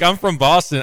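put-on light Boston accent; imitating a Boston accent. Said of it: "So he's like".

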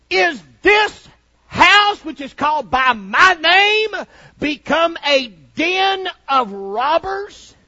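loud, high, shouted preaching; fiery preaching. Acted. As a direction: preach it hard to the congregation. Preacher at full force.

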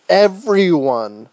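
drawn-out emphatic shout; loud. Acted. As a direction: belt it out, slow and loud. Insisting everyone must come.

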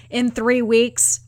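usual speaking voice

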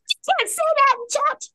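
high, quick character scolding. Acted. Stella scolds someone swearing in church, high and quick. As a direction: pitch it way up and tell them off. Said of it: "Stella points".

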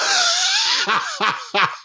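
high crying laugh; helpless shrieking laugh. Real, not acted. Bursts out laughing.

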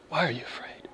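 breathy whispered question, no voice at all. Acted. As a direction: whisper it gently to a frightened listener. Whispered repeat.

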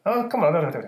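high mock-Irish leprechaun, ending in a squeal. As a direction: flail through a cartoon Irish accent. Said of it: "You want a stereotypical Irish kinda".

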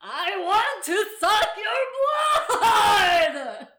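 hammed-up vampire howl, high and very drawn out; Nicolas Cage Dracula. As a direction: shout it like an over-the-top Dracula, stretching every word. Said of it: "Nicolas Cage as, like, Dracula".

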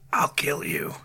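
whispered demon threat; demonic voice. Retold or imitated. Host quoting the possessed sons, demonic whisper. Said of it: "speak in a demonic voice".

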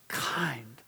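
whisper. Acted. Pastor whispers key word.

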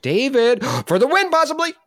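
high excited game-show call. Real, not acted. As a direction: hype up the winner. Host calls winner excitedly.

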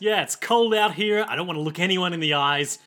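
mock New Yorker swagger; New York accent. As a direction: play a hardened Brooklyn guy who won't look at anyone. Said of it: "It's the New Yorker expression".

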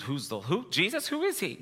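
high-pitched, mock-panicked question; retold fearful confusion. Preacher re-enacting forgetting who he is, fearful confusion. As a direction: pitch jumps up, acting out a fearful blank. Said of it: "it was just that fear".

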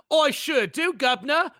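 hammed-up, high-pitched bad British accent; mock British accent. Actress claiming she can do British, in a hammed-up fake accent. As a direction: put on a cheesy, obviously fake British accent. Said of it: "do you know how to do a British accent".

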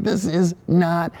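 slow, drawn-out deadpan complaint; mock-serious slow protest. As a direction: spell it out slowly, unimpressed. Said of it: "I'm like".

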